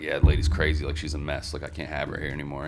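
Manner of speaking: soft, low imitation of the bartender; low-key confiding. Bartender explaining to two customers, low-key confiding. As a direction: drop down quiet and unbothered, as an aside.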